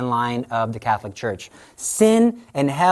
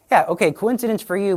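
ordinary talk

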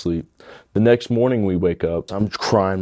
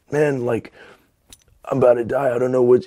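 higher-pitched, urgent alarm, acting it out; panicked retelling; 5 semitones higher than usual. Telling his roommate he's about to die, mild panic. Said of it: "and I said".

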